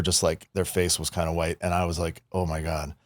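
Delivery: quiet shocked oh my God; hushed as it sinks in; hushed dismay. Retold or imitated. Realizing he scared kid.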